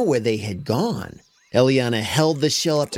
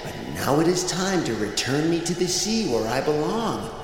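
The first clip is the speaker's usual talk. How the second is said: soft mystical voice; 4 dB quieter than usual. Acted. Shell gives final message.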